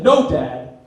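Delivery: loud, acted outburst of protest; indignant retort. Son insisting to dad he had a hamster, indignant retort. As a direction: push back hard at dad, loudly.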